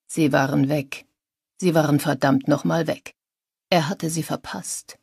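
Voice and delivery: usual speaking voice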